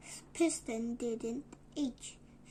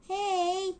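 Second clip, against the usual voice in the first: call out; 6 semitones higher, 8 dB louder than usual. Acted. Calling out in story.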